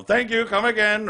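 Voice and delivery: high mock Indian accent; Apu. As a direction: mimic the catchphrase in an Indian accent. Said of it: "someone's gonna go".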